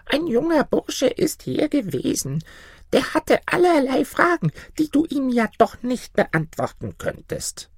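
put-on very high old woman voice; high-pitched little granny; wily old granny. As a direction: coax the griffin in a tiny crone voice. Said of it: "sagte das Mütterchen".

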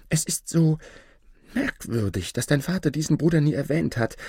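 high-pitched, animated mother weighing doubts; mother, wondering aloud. A narrator's reading. Mother persuading herself to receive the stranger, wondering aloud.

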